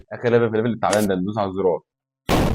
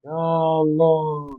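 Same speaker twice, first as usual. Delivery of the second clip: long drawn-out slow cries, higher; exasperated plea; 5 semitones higher, much slower than usual. Real, not acted. Gamer pleading with teammates, slow drawn-out exasperation. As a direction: stretch each call in disbelief.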